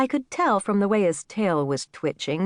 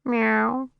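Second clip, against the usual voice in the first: mild narrated cat's meow; lightly voiced cat's reply; soft meow; 5 semitones higher than usual. A narrator's reading. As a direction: give a small, understated meow. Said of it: "there was a soft".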